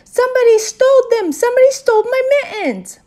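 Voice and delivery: high, loud wail of outrage; hollering. A narrator's reading. Girl alerts everyone to stolen mittens, hollering. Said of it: "I hollered".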